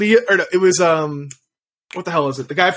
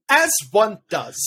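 slow, drawn-out comedian impression; John Mulaney; 3 semitones higher, slower than usual. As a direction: stretch each word like a stand-up. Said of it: "Andy Samberg does an impression of John Mulaney".

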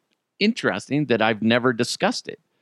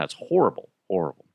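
usual speaking voice

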